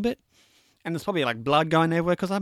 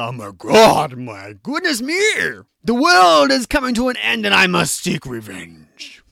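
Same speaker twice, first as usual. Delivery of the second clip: loud, drawn-out theatrical despair; mock melodrama; 2 semitones higher, 7 dB louder than usual. Mocking bad guys upset over their own brother, hammed-up melodrama. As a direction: declaim it like a ham actor vowing vengeance. Mocking villains' overblown grief.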